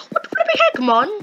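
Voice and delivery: put-on very high comic accent; Drippy. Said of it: "he had this amazing accent".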